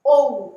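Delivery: ओ held long and loud; modelled vowel. Shown as a demonstration. Teacher repeating ओ loudly for pupils. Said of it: "फिर से देखिए".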